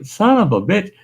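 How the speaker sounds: high-pitched mock cursing; retold exasperated curse. Man retells finding the shredded belt, exasperated. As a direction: let the pitch jump in exasperation.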